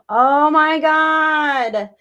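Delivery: drawn-out gasp of disbelief; breathless, awed exclamation; overwhelmed gasp. Real, not acted. Reacting to a moving tattoo.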